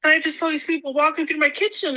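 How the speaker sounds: ordinary talk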